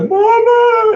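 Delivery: put-on high, loud whiny kid calling mama; whining child. Mother mimics her son whining for mama, high and loud. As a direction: wail for mama like a little kid. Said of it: "So don't try to".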